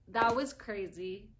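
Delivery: normal talking voice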